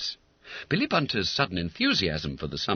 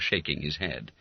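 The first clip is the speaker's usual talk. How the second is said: ordinary delivery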